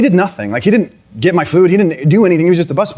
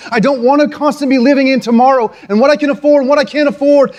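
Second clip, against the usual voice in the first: higher and faster, pleading in a rush; frustrated prayer to God; 11 semitones higher than usual. Retold or imitated. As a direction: pour out the complaint to God quickly, voice up high. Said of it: "And I was like".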